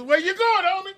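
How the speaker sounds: high, loud mock challenge; calling out. Host playing at stopping a passer-by, calling out. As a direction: holler after someone walking past. Calling out to someone walking past.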